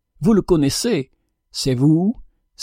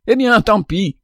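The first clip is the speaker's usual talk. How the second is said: high, raised defiant outburst; defiant cry; 7 semitones higher than usual. A narrator's reading. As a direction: fling it back in defiance. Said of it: "cria le chardonneret". (cri de défi)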